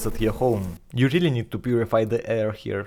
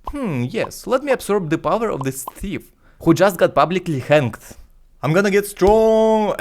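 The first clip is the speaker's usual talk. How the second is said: higher, louder, pompous fool voice; dim-witted believer; 8 semitones higher, 5 dB louder than usual. Retold or imitated. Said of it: "and yet people were like".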